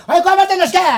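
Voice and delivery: high, loud burst; angry shout. Acted. Demanding to be allowed to speak, angry shout. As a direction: yell over everyone to get a word in.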